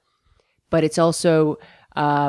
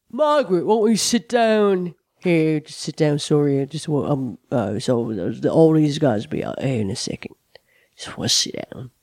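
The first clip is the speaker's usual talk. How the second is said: [name]; 4 semitones lower than usual. Retold or imitated. [name] invites a frozen fan to sit, gently. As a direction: coax her to sit down. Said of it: "Billy finally goes".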